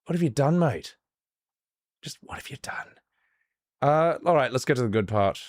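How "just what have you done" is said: aghast whisper. Real, not acted. Stunned reaction to game dub.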